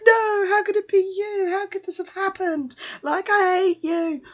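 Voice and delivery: very high, wailing mock despair; squeaky melodramatic protest; mock horror. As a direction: cry out in hammed-up dismay. Horrified reaction to the nurse reveal.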